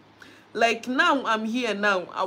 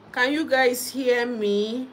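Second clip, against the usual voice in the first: ordinary talk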